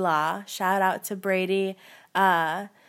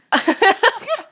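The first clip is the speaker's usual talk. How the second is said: squealing laugh, much higher; high burst of laughter; 9 dB louder than usual. Real, not acted. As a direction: break into a high giggle. Laugh after switching voice.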